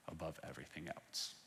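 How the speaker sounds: ordinary talk, nothing marked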